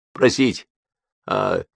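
steeling yourself to speak; plucking up courage. A narrator's reading. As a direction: force it out after hesitant pauses. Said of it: "собравшись с духом, вымолвил он".

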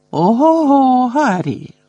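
high, loud whoop of amazement; astonished, drawn-out high exclamation; impressed exclamation. A narrator's reading. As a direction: cry out, thoroughly impressed. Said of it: "вражено вигукнув Лі".

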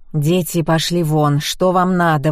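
ordinary delivery, nothing marked

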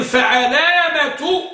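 فعلامة stretched slowly and pitched high; high, lingering emphasis on فعلامة; stress on فعلامة. Shown as a demonstration. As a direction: draw out فعلامة to show its ع. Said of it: "وعلامة في عين".

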